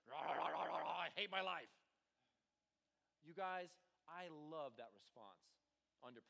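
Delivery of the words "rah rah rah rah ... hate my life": loud, hammed-up grumble; mock complaining rant, louder; mock grumbling. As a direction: grumble and moan like a sore loser. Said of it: "and not this".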